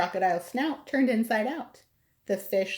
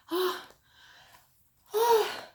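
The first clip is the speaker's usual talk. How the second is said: rising pre-sneeze ah-ah; sneeze wind-up; 13 semitones higher than usual. Acted. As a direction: build to a huge sneeze, pitch climbing. Sneeze building up.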